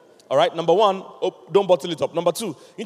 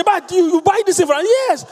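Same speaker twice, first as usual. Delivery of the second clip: high, loud mock dialogue; quarrelling friends, voiced high; acting out the friend; 11 semitones higher, 6 dB louder than usual. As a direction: play both friends, pitched way up. Acted dialogue of friend venting.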